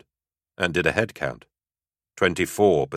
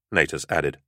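ordinary delivery, nothing marked

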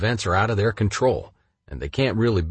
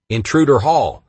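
higher, louder robotic alarm announcement; computerized alarm voice; 6 semitones higher, 6 dB louder than usual. Retold or imitated. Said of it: "alerted me with a computerized voice".

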